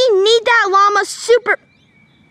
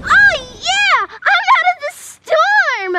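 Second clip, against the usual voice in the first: triumphant cheer; 10 semitones higher, much slower than usual. Acted. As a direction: celebrate like you just escaped. Escaped the storm, cheering.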